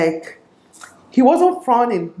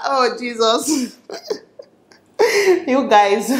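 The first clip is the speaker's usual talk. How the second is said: laughing it off, slow and high; embarrassed laugh through the words; real laughter; 4 semitones higher, much slower than usual. Real, not acted. Laughing at herself while telling viewers the story.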